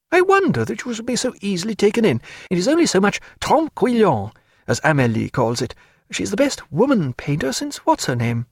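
a hint of a lively lady mocking her paint; Diana. A narrator's reading. Narrator voicing Diana.

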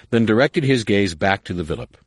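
usual speaking voice

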